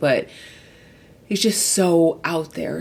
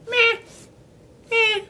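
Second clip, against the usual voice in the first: much higher, unimpressed mock shrug; dismissive meh. Reviewer imitating her own lukewarm verdict, mock unimpressed. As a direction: brush the book off with a bored meh. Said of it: "here I am just being like".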